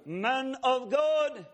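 slow, drawn-out high call of "God"; calling, closer. Retold or imitated. Stranger calling nearer through the airport, slow and high. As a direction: call it slowly, a little nearer. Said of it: "the voice gets a little closer".

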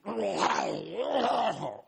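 menacing demonic cackle; a ferocious evil laugh. Retold or imitated. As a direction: demon laughing through her. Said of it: "very violent and very ferocious sounding".

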